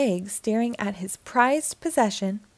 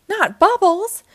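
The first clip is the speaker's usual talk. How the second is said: high, loud group shout of alarm; loud scared cry of the whole gang; 10 semitones higher, 9 dB louder, slower than usual. Acted. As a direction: yell it out in a panic. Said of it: "shouted his new friends".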